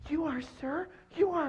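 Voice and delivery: very high, thin snake voice; squeaky snake. Acted. Snake answers lion very high.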